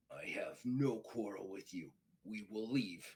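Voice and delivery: low, soft, controlled concession; restrained Klingon. Acted. Klingon telling the officer he will leave, restrained.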